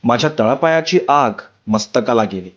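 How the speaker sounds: ordinary delivery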